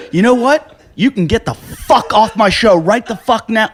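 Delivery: mock outrage at the guest; angry host. Angry host throws him out.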